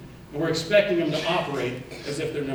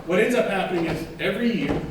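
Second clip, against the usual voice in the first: normal talking voice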